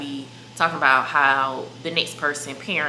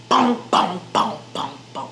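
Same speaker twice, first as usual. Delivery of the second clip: mock raid booms. God raiding like the feds.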